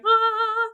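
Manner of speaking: high, loud held "ah"; imitation of a showy performer's high note; imitated stage singing. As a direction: belt out a mock spotlight note.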